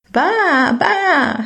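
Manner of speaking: high, wavering baa like a ewe; sheep bleat. Acted. Ewes greeting the little mouse, high bleating baa.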